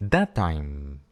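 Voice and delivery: "That time" with one held T; quiet linked "That time"; lengthened linked T. Shown as a demonstration. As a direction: stretch the shared T. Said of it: "alargamos la consonante un poco".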